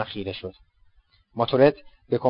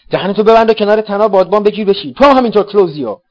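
higher, loud snap to shut up; angry shouted order; 9 semitones higher, 10 dB louder than usual. Acted. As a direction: silence them sharply. Narrator orders friends to shut up. (دستور خشمگین و فریادوار)

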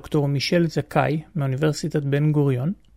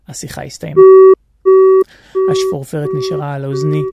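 ordinary talk

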